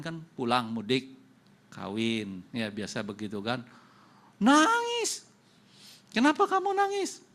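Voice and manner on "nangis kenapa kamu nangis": much higher, loud wailing pitch; mock crying whine. Preacher mimics the tearful maid refusing to leave, high whine. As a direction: squeal it like a weeping maid.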